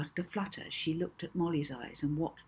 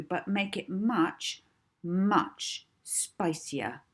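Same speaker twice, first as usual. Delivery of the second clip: reckless commands; 5 semitones higher, 5 dB louder than usual. Acted. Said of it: "added recklessly".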